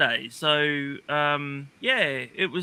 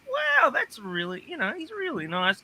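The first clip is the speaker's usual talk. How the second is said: amazed; 8 semitones higher, 4 dB quieter than usual. Retold or imitated. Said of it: "And I was like".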